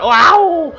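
loud, high shout of amazement; amazed wow. Real, not acted. Gamer yelling at merging monsters in surprise. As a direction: blurt it out, stunned. Head monster eats dog monster.

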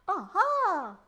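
very loud, high delighted discovery; big gleeful exclamation; cry of surprise. A narrator's reading. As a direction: burst out in surprise. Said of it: "the gecko cried in surprise".

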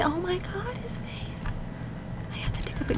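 breathless giggly whisper. Real, not acted. Player whispers, stifling laughter at the Sim's face. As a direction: whisper it through suppressed laughter.

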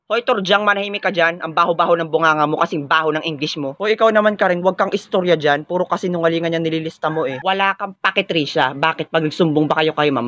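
normal talking voice

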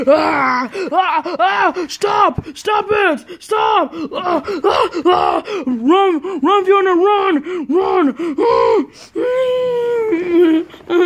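high play-scared screams and cries; mock scream. Pretend parent fleeing the monster, high mock screams.

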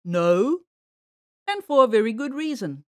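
firm, loud answer from hiding; a touch of a sly bear; lightly voiced hidden bear. A narrator's reading. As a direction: answer with weight, as a concealed bear.

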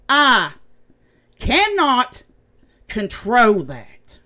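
loud, heated insistence; furious at the chat; angry. Real, not acted. Angry rant at viewers.

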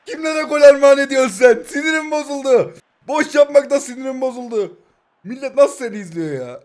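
annoyed viewer yelling at the streamer; mock angry rant. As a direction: scold the YouTuber like a fed-up viewer. Mock angry viewer scolds him.

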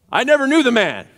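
high, loud snap back; shouted denial. Acted. Peter denying Jesus to a slave girl, shouting. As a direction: deny it in a sudden yell.

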